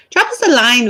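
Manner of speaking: "Drop us a line" in a playful hint of Irish lilt; a light Irish lilt on "Drop us a line", said for fun; a touch of Irish brogue on "Drop us a line"; light Irish accent. Shown as a demonstration. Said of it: "I don't know why I became Irish today".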